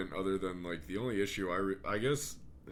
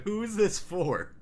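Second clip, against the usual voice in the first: incredulous; 12 semitones higher, 7 dB louder than usual. Retold or imitated. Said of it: "it cracks me up 'cause it's like".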